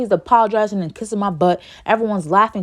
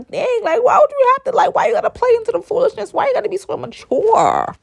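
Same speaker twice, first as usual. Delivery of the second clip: high, louder, exasperated plea; high-pitched protest; 14 semitones higher, 4 dB louder than usual. Retold or imitated. Student to her laughing crush, high exasperated protest.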